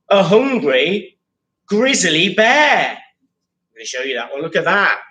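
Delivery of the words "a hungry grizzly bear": drawn-out, dramatic reveal; slow suspense. Acted. Teacher builds suspense for the class, slow dramatic reveal. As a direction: stretch each word to build suspense.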